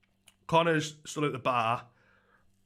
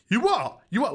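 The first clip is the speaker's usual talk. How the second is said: mock aggressive challenge; 7 semitones higher than usual. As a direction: bark it back at him like a hard man. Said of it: "Like that".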